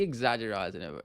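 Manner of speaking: "exaggerating" garbled as "exaggerizing"; mispronounced "exaggerating". Real, not acted. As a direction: slip an "eyes" sound into "exaggerating". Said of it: "How have I just said that as a word?".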